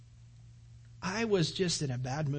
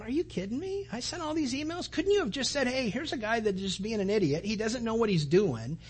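higher, exasperated venting; steaming rant; 5 semitones higher than usual. Retold or imitated. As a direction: rant at them, fed up. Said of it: "I'm kinda steaming".

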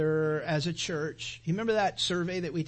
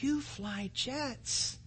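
higher, slow, drawn out wife; slow teasing reminder; 8 semitones higher, 4 dB quieter, much slower than usual. Retold or imitated. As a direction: stretch it out gently. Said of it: "She goes".

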